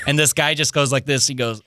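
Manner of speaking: ordinary talk, nothing marked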